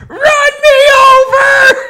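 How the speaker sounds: high, loud mock scream through laughter; mock screaming kid. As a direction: shriek it like the kid begging, laughing. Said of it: "He's just fucking screaming".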